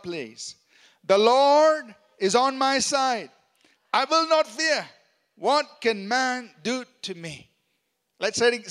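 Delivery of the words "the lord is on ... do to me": slow, loud declaration of scripture; read out loud. Shown as a demonstration. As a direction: proclaim it slowly to the room. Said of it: "Let's read it out".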